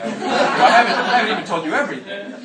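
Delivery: over-the-top parody of a man listing his merits; mock boastful suitor. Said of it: "he lists about three more things".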